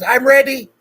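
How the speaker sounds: high, loud burst of eagerness; eager shout. Retold or imitated. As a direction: blurt it out like a kid who can't wait. Retold jumping up eager for mom.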